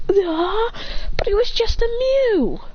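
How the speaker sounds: high-pitched acted whine of objection; high protest. A narrator's reading.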